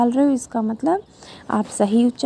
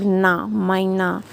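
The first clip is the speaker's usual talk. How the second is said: slow, syllable by syllable; slow teaching pronunciation; slow sounding out; 3 semitones lower, much slower than usual. Shown as a demonstration. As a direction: sound out "मैना" slowly. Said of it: "दो मात्रा लगाओ".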